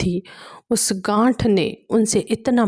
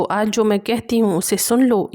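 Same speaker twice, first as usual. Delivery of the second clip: ordinary talk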